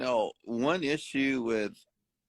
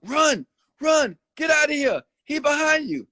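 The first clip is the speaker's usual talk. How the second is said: much higher, louder cheering-on of a fleeing man; mock crowd urging; 4 dB louder than usual. Bystanders yelling at the fleeing man, imitated high and loud. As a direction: hype him up to run from the cop.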